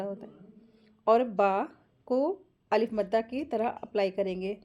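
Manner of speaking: normal talking voice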